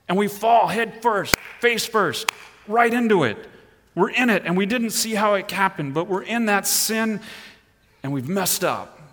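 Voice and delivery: fiery preacher building to a peak; preaching at full force. Acted. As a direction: drive the point home from the pulpit.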